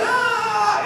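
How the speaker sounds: high mock cheer from the audience; imitated crowd cheer. Graduation crowd cheering his news, high mock cheer. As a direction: squeal like the clapping crowd. Everybody started clapping.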